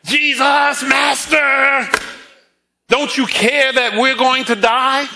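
high, urgent mock scream of terrified disciples; panicked scream. Disciples crying to Jesus over the storm, mock panicked scream. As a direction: cry out in panic over the storm, half-performed. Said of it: "screaming at the top of their lungs".

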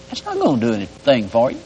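usual speaking voice

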